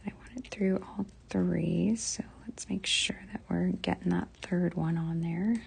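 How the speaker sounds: whispering to herself while fiddling with wire; barely voiced, talking to herself under her breath; muttered whisper. Real, not acted. Crafter whispering to herself over the fiddly wire, hushed.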